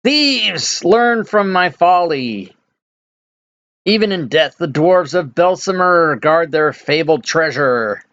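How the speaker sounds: high-pitched, drawn-out ghostly cry, not full volume; ghost's theatrical mock scream, pitched high. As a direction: wail it at intruders like an angry ghost. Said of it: "the ghost figure appears and screams".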